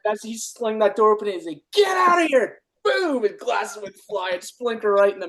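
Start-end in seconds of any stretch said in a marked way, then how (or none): 1.7-2.6 high-pitched retold shout; imitated mad yell. Timmons slings the door open at his handlers, mad. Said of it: "He was so mad". 2.8-3.2 high, sudden sprinkler-blast boom; imitated impact boom. Storyteller mimics the sprinkler knocking glasses off, booming.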